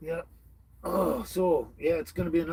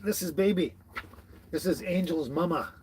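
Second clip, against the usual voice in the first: ordinary talk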